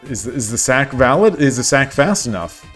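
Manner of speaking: ordinary delivery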